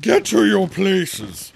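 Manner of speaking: high, loud, drawn-out command; thunderous shout. A narrator's reading. Queen orders the players to start, thunderous shout. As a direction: thunder it at a crowd. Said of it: "in a voice of thunder".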